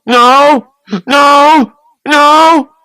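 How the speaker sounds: high, loud repeated screams of no; screaming no. A narrator's reading. Raymond screaming in terror at the bath water, high and loud. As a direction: scream it over and over in panic. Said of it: "Raymond began to scream".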